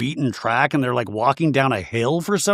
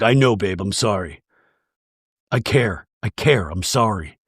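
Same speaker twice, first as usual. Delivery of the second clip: mock apology; 5 semitones lower than usual. Retells Jared apologizing to Brittany.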